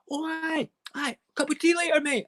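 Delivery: very high, chirpy and matey; sudden friendliness. Retold or imitated. Man mid-siege agrees to tea, high and chummy. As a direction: imitate an absurdly cheery neighbour. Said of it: "his tone totally changed".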